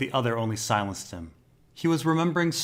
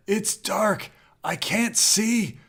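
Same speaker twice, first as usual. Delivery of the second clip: much higher, slow frightened whimper; whimpering terror; 11 semitones higher, much slower than usual. A narrator's reading. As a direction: plead slowly, voice cracking high. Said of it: "unsteady and whimpering".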